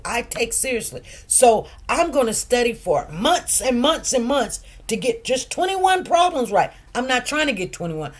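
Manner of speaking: ordinary talk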